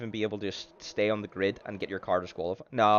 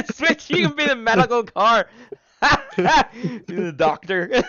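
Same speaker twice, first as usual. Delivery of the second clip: laughing; 13 dB louder than usual. Real, not acted. Teasing co-host, laughing fit.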